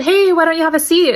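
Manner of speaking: high, loud teacher call-out; firm classroom call across the room; calling out sharply. Retold or imitated.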